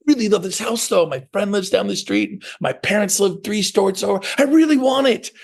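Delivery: high-pitched mock buyer pleading; eager house buyer. Buyer who badly wants the house, mock eager. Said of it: "what if you think".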